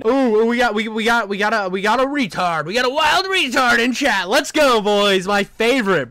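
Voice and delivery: loud, high, stuttering excitement; gleeful hype. Real, not acted. Streamer spotting a troll in chat, loud gleeful hype. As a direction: crow it to the crowd.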